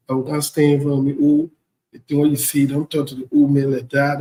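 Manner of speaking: normal talking voice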